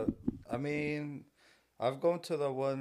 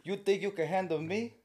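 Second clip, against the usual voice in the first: mock old-time boxer; 7 semitones higher than usual. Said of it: "I don't even know who he sounds like".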